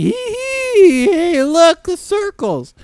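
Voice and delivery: high, squealed giggly put-on voice; giddy tipsy voice. Joking about being drunk, giddy high voice. Acting someone giddy from drink.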